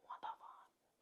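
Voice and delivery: whispered disbelief, almost no voice; shocked whisper. Retold or imitated. Friend reacting to the story in a stunned whisper.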